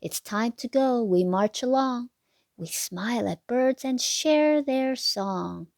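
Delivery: put-on lilting sing-song read to little kids; bouncy storybook rhyme voice; reading to children. A narrator's reading. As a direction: read the rhyme like a bedtime picture book. Rhyming picture book read aloud.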